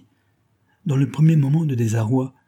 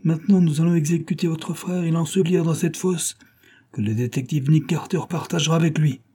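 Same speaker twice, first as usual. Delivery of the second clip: higher, lightly voiced gang boss; stern gang leader; 7 semitones higher than usual. A narrator's reading. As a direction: announce the execution coldly.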